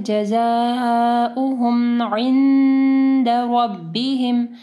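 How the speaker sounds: slow chanted जज़ाउहुम इंद रब्बिहिम; long zaa in जज़ाउहुम, humming held n in इंद; ikhfa ghunnah. Shown as a demonstration. Teacher recites for students, stretched madd and ghunnah. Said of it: "इख्फा गुन्ना होगा".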